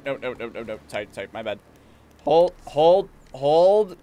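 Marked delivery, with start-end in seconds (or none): 2.2-4.0 loud, high calls to halt; urgent command. Pretend. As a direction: shout the horse to a stop. Commands horse to stop.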